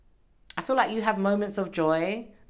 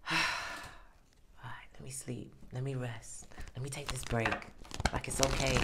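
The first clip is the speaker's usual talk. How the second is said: hushed, drowsy near-whisper; sleepy relief; 4 semitones lower, 9 dB quieter than usual. Pretend. Tired worker finally letting go, soft sleepy murmur. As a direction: sink into sleep as you say it. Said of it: "where you can just go".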